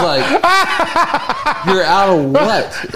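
squeaky laughing disbelief; cracking up in disbelief. Real, not acted. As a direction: laugh through the question.

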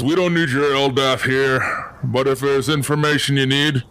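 lightly gruff townsman; a hint of a gruff, no-nonsense local. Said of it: "a gruff voice coming from behind you".

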